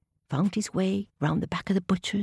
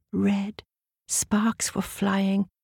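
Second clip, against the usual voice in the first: ordinary delivery, nothing marked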